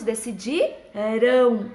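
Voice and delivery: "decidirão" split, with the final "rão" held long; stressed future ending. Shown as a demonstration. Teacher showing pupils the future tense, stressed ending on "decidirão". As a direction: lean on the last syllable of "decidirão". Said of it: "terminação pro futuro a gente escreve com ão".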